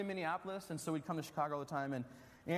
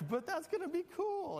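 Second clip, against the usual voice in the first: high, pleading and eager; 11 semitones higher than usual. Retold or imitated. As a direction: sell it like a hopeful kid. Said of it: "And I was like".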